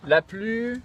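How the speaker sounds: high, loud, stretched syllables; drawn-out, projected chant-like reading; mock Iraqi reading. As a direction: intone it haltingly as if reading Iraqi. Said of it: "je vais vous le lire en irakien".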